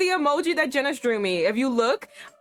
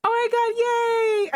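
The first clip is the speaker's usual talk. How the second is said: excited squeal; 12 semitones higher, slower than usual. Real, not acted. Streamer thrilled at her emojis, high squeal. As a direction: burst with glee.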